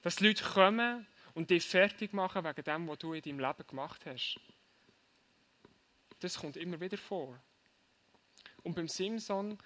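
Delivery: ordinary talk, nothing marked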